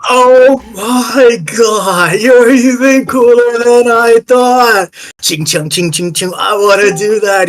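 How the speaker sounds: high, loud, gushing fanboy; starstruck fan. Acted. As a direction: gush over your hero, voice shooting up.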